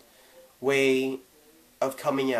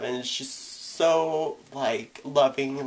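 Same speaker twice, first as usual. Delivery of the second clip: ordinary talk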